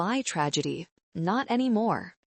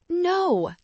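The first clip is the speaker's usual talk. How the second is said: high, loud scream of refusal; 12 semitones higher, 3 dB louder than usual. A narrator's reading. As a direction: scream it like a tantrum. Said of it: "Kevin screamed".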